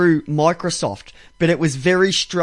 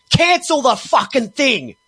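exasperated demand; 5 semitones higher, slower than usual. Retold or imitated. Customer stuck in support loops.

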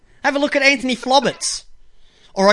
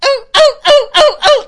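high, loud dolphin squeaks; much higher, louder mock dolphin calls; dolphin impression; 9 dB louder than usual. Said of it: "he sounds like the fish behind him".

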